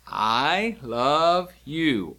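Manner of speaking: each word stretched out very slowly; slow demonstration. Shown as a demonstration. Teacher showing learners how to sign I love you, slow demonstration. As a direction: draw out every word, one at a time.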